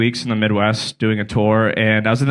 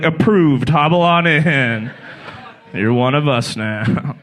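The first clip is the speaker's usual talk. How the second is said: mock official welcome; much slower than usual. Official welcomes him, slowed.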